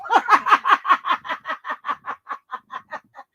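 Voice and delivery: long, high laughter tailing off; real laughing fit. Real, not acted. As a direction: crack up until you run out of air. Cracks up at mama's threat.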